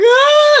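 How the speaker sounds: high loud startled shriek. Retold or imitated. Winona shrieking as the gun goes off, a high scream. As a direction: let out a sudden high scream. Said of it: "Winona's like".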